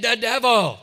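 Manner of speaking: loud mock group answer; shouted response. Boys answer their leader in church, loud mock yell. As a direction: imitate boys yelling back in drill.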